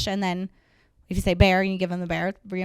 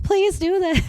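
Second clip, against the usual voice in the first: high, begging plea to the dog; pleading owner; 11 semitones higher, 5 dB louder, slower than usual. Retold or imitated. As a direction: beg the dog helplessly. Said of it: "you're like".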